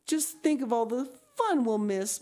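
high, plaintive lament; a hint of mourning in her voice; mournful. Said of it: "mourned Diana".

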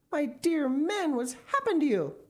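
wife crying out at her shrunken husband. Acted. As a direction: ask the husband in horror. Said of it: "cried Missus Gregg".

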